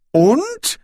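very high questioning exclamation; astonished, high-pitched comeback; surprised. A narrator's reading. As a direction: shoot the pitch right up in surprise. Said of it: "erwiderte Brokando überrascht".

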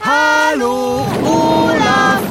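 lang gedehnter, lauter Ruf. Pretend. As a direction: ruf es langsam übers Meer. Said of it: "Auf die Plätze, fertig, los". (lauter, gedehnter Ruf)

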